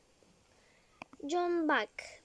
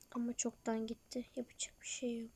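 whisper; 4 semitones lower, 9 dB quieter than usual. Acted. Regretting at night.

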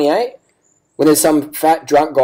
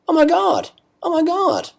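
high-pitched, softened mock amazement; mock shock; 3 dB quieter than usual. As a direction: feign astonishment in a high voice. Feigned shock at pro's bike.

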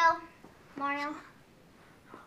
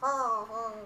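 lower, soft oh calls; Yoshi voice; 6 semitones lower, 5 dB quieter, much slower than usual. Shown as a demonstration. Demoing their Yoshi for viewers, low. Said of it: "this Yoshi talks more like".

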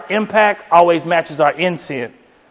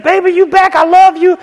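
sweet wife voice; 12 semitones higher, 5 dB louder than usual. Retold or imitated. Wife greets him at garage.

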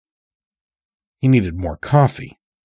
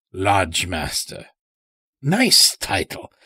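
put-on a higher, odd country voice for the old stranger; sly old-timer with an unplaceable accent; Morris's strange accent; 5 semitones higher than usual. A narrator's reading. Chained old stranger talking to the lodge master, in an odd accent. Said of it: "spoke in such a strange way".

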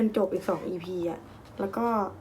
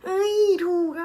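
delighted cry; 9 semitones higher, 7 dB louder, much slower than usual. Real, not acted. Surprised she got it right.

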